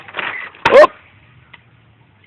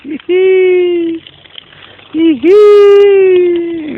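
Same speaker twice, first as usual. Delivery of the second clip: breathy cutesy giggly; 11 semitones higher, much slower than usual. Real, not acted. Mother giggles at little one.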